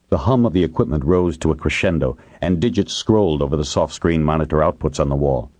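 ordinary delivery

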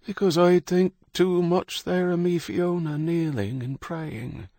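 put-on high, cracked, worn-out old man; tired old Irishman, fading; weak old voice. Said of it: "spoke in a weak voice".

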